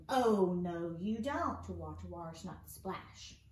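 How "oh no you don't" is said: loud acted storybook scolding; mock shout, loudest at the start; playful shout. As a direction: call it out loudly as a scolding character. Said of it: "Mister O is shouting".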